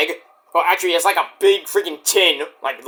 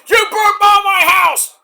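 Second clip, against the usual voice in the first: high-pitched, loud imitated accusation; hammed-up angry yell; Adam Page yelling; 12 semitones higher, 5 dB louder than usual. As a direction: shout it like an outraged wrestler. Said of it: "Page and Swerve are yelling at each other".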